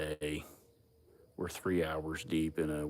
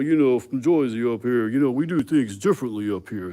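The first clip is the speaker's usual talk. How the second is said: loud, higher-pitched Jersey imitation; loudly mocking a Jersey accent; 4 semitones higher, 10 dB louder than usual. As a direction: imitate a cocky guy from Jersey. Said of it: "Fucking Jersey accent".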